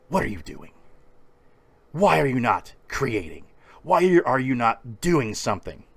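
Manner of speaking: stern motivator confronting you; demanding questions, punched and spaced out. Acted. Motivator steps from the headphones to confront him, stern. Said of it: "[name] comes out of my headphones".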